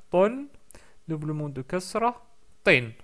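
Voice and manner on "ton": « ton » appuyé, plus haut et plus fort. Shown as a demonstration. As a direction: finissez nettement sur « ton ». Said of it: "Double mot de dhamma". (« ton » emphatique)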